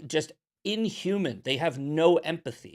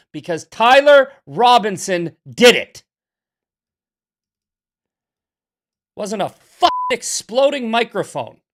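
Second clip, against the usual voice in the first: much louder, higher angry yell; furious shout; 6 semitones higher, 13 dB louder than usual. Real, not acted. Shouting at Candace Owens over the microphone claim, furious. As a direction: blast it out in real fury.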